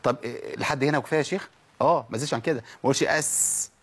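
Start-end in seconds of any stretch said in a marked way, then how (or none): 3.0-3.7 "أس" with the hiss held too long; exaggerated, stretched hiss on "أس"; overdone seen. Shown as a demonstration. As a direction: drag out the s of "أس". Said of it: "كده زودت، زودت".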